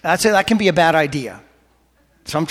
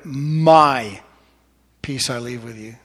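heavy emphasis on the word My; stress on My; 3 semitones lower, slower than usual. Shown as a demonstration. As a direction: lean long on My, then let the phrase fall away. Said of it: "Emphasize one word".

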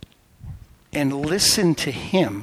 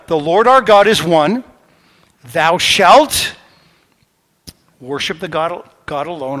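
proclaiming; 2 semitones higher, 8 dB louder than usual. Acted. Preacher proclaiming the Shema to the congregation, loud. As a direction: declare it to the whole room.

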